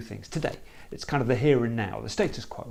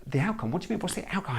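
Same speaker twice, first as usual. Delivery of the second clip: mock-puzzled, softer, pitch jumping up; puzzled problem owner; 8 dB quieter, faster than usual. Facilitator mimicking a thrown problem owner, lightly puzzled. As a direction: act out the owner caught off guard. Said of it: "the problem owner will say".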